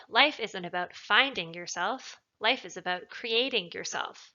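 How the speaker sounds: "finding" and "creating" lifted and stressed. Shown as a demonstration. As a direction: punch "finding" against "creating". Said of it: "finding and creating were not italicized".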